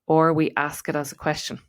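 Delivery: ordinary talk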